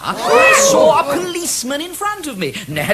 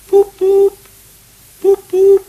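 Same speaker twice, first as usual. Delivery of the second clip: high, loud hooted toots; motor horn imitation; 10 semitones higher, 9 dB louder, slower than usual. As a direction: toot like an old car horn. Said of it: "Imitation of motor car".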